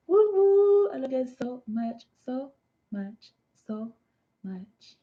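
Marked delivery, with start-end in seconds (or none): 0.1-0.9 high, loud double whoop; high "woo" cheers; celebratory whoop. Real, not acted. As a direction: let out two party whoops.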